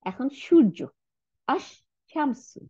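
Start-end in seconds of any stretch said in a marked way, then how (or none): 1.5-2.6 "আস-শামসু" very slowly with a long SH; slow model of "আস-শামসু", SH held; doubled SH. Shown as a demonstration. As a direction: draw out the SH in "আস-শামসু", no L sound. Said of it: "দুইটা সিন বানাই দিছে".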